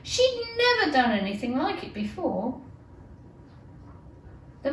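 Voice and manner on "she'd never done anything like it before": plain reading voice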